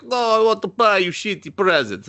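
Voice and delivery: high, hammed-up Eastern European accent; mock Eastern European accent. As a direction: imitate a terrible fake Eastern European accent. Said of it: "And they all talk like that".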